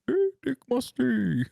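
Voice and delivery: high, slow calling-out voice; mock shout. Imagined yell across the funeral home, high mock call. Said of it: "I just wanted to scream it across the funeral home".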